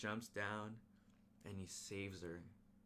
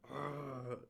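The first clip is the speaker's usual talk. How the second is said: mock yell; 5 semitones higher than usual. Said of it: "and he goes".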